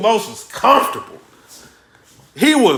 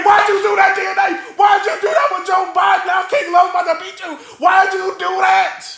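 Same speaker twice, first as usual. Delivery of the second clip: screaming at the TV; 8 semitones higher than usual. Retold or imitated. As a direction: yell at the rapper like he can hear you. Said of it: "I'm screaming at the T V".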